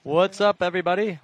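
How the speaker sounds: loud, hyped greeting. Real, not acted. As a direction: burst in with a big hello. Loud greeting joining booth.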